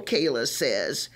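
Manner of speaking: ordinary delivery, nothing marked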